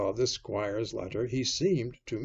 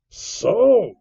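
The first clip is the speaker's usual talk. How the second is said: high, loud outburst; sudden raised-voice cry; 13 semitones higher, 12 dB louder than usual. A narrator's reading. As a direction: exclaim it in indignation. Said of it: "cried Silver".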